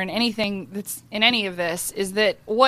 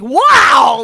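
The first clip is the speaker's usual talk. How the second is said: mock outburst; 2 semitones higher, 9 dB louder than usual. Mocking her own reaction to the creep, a loud sarcastic exclaim.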